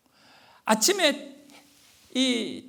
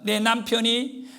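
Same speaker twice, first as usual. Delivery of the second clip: usual speaking voice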